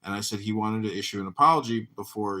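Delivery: ordinary talk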